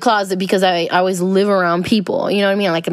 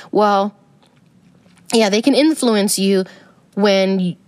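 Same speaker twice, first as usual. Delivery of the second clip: ordinary talk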